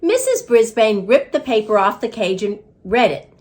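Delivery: ordinary delivery, nothing marked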